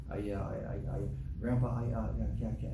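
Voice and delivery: low, quiet mimicked stammer; soft stuttering grandchild. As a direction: mumble haltingly like a little kid stuck on words. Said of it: "he talks softly".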